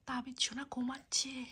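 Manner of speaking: soft unvoiced whisper; mother whispering while her son sleeps. Real, not acted. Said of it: "আমরা আস্তে আস্তে কথা বলছি".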